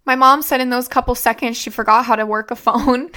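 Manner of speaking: ordinary talk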